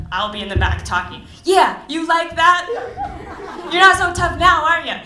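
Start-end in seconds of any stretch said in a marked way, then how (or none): 1.4-5.0 high, loud hammed-up trash talk; mock taunt from the sidelines; aggressive taunting. Cheering Alex on from the back, loud high mock trash talk. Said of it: "I don't know what that means, but something aggressive".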